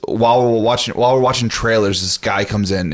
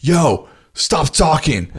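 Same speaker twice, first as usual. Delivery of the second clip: higher, drawn-out mock yell without much volume; retold yell; 5 semitones higher, much slower than usual. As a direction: call him out slowly, each word stretched. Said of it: "and I just yell".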